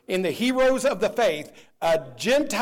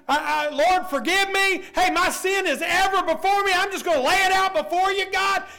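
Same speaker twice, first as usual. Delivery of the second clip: high, loud pleading prayer; a preacher shouting David's confession; voicing David's plea; 11 semitones higher, 4 dB louder than usual. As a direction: cry it out to God at full voice.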